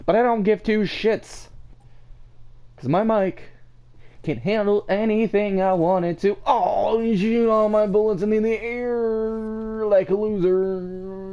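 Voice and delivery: high, excited gloating; cocky high-pitched bragging; hyped-up trash talk. Real, not acted. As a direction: crow over the other players.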